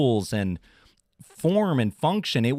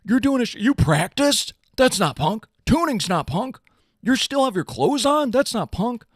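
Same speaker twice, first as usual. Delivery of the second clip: high whiny mock-punk sneer; high snotty impression of rule-bound punks; 9 semitones higher than usual. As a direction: lampoon the gatekeepers in a pitched-up voice.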